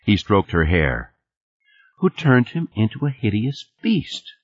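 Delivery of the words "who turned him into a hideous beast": lightly voiced child, raised pitch; a hint of a small girl telling a tale; little daughter reciting. A narrator's reading. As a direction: recite it as a little kid would.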